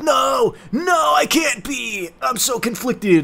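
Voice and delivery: high, drawn-out anguished wail; hammed-up melodramatic cry of denial; mock suffering. Halfling learns she is half human, mock anguished wailing. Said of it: "suffering from the revelation".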